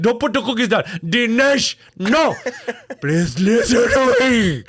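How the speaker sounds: put-on upset Indian IT guy accent, rising to a shout; weary patient tech support. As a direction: Indian accent, pleading then yelling at users. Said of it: "an impression of an I T guy now just getting upset".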